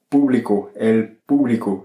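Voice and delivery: público stressed on PÚ, then falling; stress on first syllable. Shown as a demonstration. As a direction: punch the opening PÚ of público and let the rest drop. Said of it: "avec un accent sur le U".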